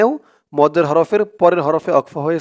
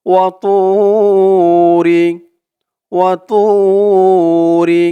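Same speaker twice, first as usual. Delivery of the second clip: Quran recitation; 2 semitones higher, 5 dB louder, much slower than usual. Shown as a demonstration. Teacher reciting for viewers to repeat, slow tajweed chant. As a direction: recite it slowly so students can follow. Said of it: "recitation demo".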